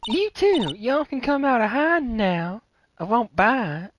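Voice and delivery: chirpy country gal perking up at a murder; Southern Lotta voice. Acted. Lotta hears about a murder, high eager Southern twang.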